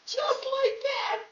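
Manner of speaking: high slow howling through laughter; laughing shriek. Real, not acted. Cracking up at the politician joke, high laughing squeal.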